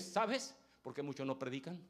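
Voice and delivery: ordinary talk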